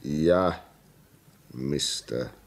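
slow, low, halting admission; grave confession. Acted. Signalman admitting he has seen the ghost, slow and grave. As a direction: admit it slowly and heavily, with pauses.

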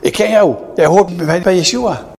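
high, insistent voice pointing him out; accusing servant girl. Acted. Servant girl accusing Peter at the fire, high and pressing. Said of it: "Een dienstmeisje ziet hem en die zegt hem".

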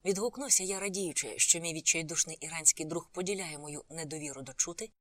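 plain reading voice

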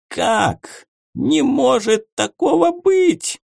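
can't believe your eyes; shocked disbelief. A narrator's reading. Homunculus shocked.

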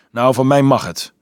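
grumbling. A narrator's reading. Dolf dismissing the objection about the lord's game, grumbling. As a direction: give in with a grumble. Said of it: "gromde Dolf".

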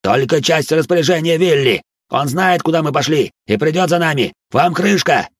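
high angry shouting; furious shout. A narrator's reading. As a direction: yell the threat hard. Bandit keeps threatening, shouting.

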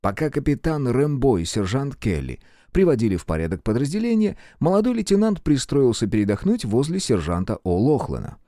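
plain reading voice

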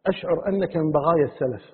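retold admirer saying بغايا with "gh" for q; earnest mispronunciation, "بغايا" with a gargled ghayn; qāf said as ghayn. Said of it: "والقاف نقولها غين".

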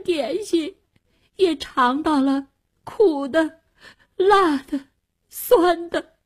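put-on high, tearful child pleading; ashamed and tearful. A narrator's reading. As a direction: plead through tears, full of shame. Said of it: "惭愧万分".

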